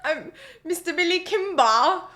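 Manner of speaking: "Kimber" with a hard K and an open "-bah"; Billy Kimber accent. Retold or imitated. The better take of the gangster's accent in the say-off. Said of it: "that's the better accent".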